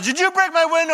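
high, loud accusing question; accusing Fred. Acted. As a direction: demand an answer about the window.

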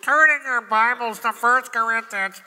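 hammed-up tedious preacher; silly boring preacher voice. Shown as a demonstration. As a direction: ham up a dull pulpit voice. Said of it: "I'm being silly, aren't I?".

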